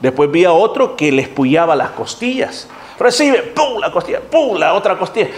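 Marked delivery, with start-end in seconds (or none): 3.0-5.3 high-pitched imitation with punched sound effects; mock forceful ministering. Minister poking ribs to force laughter, mock excited. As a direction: act out jabbing with each pum.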